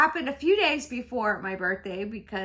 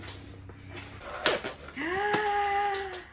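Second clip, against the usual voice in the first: one long surprised breath in; delighted gasp; 8 semitones higher than usual. Real, not acted. Wife unwrapping husband's gift, a long delighted gasp.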